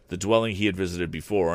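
ordinary delivery, nothing marked

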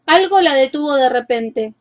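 plain reading voice

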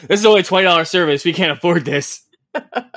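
hammed-up penny-pinching manager; mock worried staffer. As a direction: play at hastily refusing an expensive item. Mock staffer rejects the good game.

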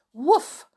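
a small, barely voiced mock dog woof; a polite little bark, hardly voiced. A narrator's reading. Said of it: "said Timmy politely".

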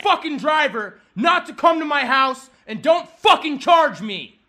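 loud, angry shouted order; furious demand. Real, not acted. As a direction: lay down the law, drawing out the last words. Demands driver stay away, furious.

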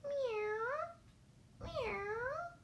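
very high, drawn-out mew; high storybook kitty cry; cat meow. Acted. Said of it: "cried the cat".

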